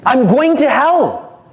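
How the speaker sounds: high, slow, loud wail of doom; panicked disciple. Acted. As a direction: moan it like you're already damned. Disciple wailing to the guru.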